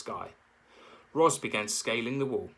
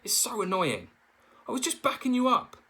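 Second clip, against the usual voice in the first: whiny bickering; 12 semitones higher, 4 dB louder than usual. A narrator's reading. Bears bicker.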